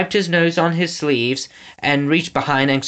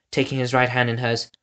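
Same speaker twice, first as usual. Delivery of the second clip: ordinary delivery, nothing marked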